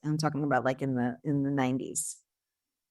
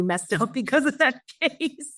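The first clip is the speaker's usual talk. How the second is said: higher voice breaking into laughter; laughing; 8 semitones higher than usual. Real, not acted. Prosecutor admitting the whole team was messed up, laughing. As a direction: laugh through the end of the sentence. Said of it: "I'm laughing".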